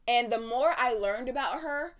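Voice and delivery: usual speaking voice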